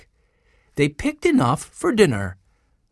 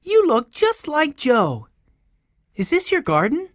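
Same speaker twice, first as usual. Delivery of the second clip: put-on high, curious child; little boy Benny; 12 semitones higher than usual. A narrator's reading. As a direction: pitch it up like a small child. Little Benny questioning Joe.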